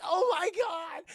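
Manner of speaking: high, slow, loud horrified cry; imitating a screaming victim; panicked scream. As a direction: shriek it out. Protector panicking.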